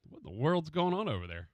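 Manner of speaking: ordinary talk, nothing marked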